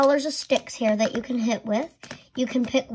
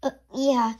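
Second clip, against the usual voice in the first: ordinary talk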